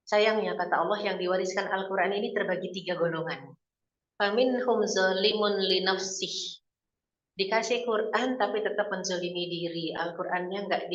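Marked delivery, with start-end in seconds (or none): 4.2-6.6 slow, measured recitation; Quranic recitation. Acted. Preacher quoting the Quran to the congregation, slow recitation. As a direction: intone the verse slowly.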